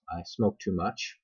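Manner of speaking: ordinary talk, nothing marked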